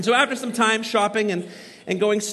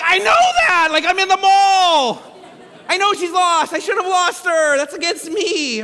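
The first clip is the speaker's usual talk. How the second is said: much higher, louder, exasperated outburst; frantic retort; 9 semitones higher, 8 dB louder than usual. Retold or imitated. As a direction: snap back in panic and frustration. Frantic dad answers snarky mom.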